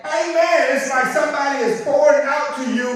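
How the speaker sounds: loud, high preacher's cry; full-force sermon shout; fiery preaching. Acted. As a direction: preach it out to the congregation.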